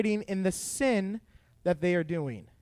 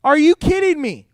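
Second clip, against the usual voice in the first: incredulous; 8 semitones higher, 9 dB louder than usual. Real, not acted. Preacher's incredulous outburst.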